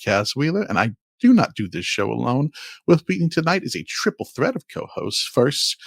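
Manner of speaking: usual speaking voice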